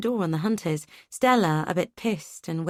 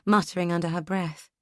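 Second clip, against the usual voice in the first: ordinary delivery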